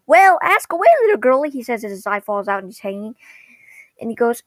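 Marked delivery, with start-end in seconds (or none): 0.1-1.5 high, loud mascot voice; cheery mascot. Retold or imitated. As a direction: answer a child with hammed-up cheer. Mascot cheerily answers a child.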